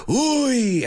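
high groaning uy; imitating Spanish uy. Host imitating a Spanish crowd's uy at a missed penalty. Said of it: "everybody was like".

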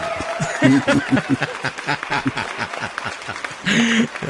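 long burst of laughter; hearty laugh. Real, not acted. Laughing at the space seal joke.

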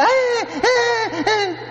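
put-on high squealing baby cries with a laugh; baby fussing imitation. Priest mimics the baby squirming on the plane, high squeal.

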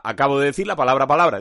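quick, run together, palabra palabra tossed off; quick throwaway demo of the phrase; fast rattled repeat. Shown as a demonstration.

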